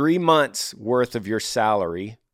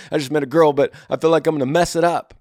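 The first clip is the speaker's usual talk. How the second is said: higher-pitched anxious mock emailer; all nervous; 5 semitones higher, 4 dB louder, faster than usual. Imitating a viewer emailing about a new girl, all nervous. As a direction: act out a worried guy fretting he'll blow it. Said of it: "all nervous".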